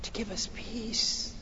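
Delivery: soft whispered reverence; hushed preaching. Real, not acted. Preacher explaining why Jesus came, hushed. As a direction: let it sink to a reverent hush. Hush after emphatic preaching.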